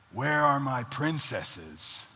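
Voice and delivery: slow, put-on storybook king; changed voice, drawn out like a pretend monarch; playful king voice. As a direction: play a king grandly looking for his daughters. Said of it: "I change my voice".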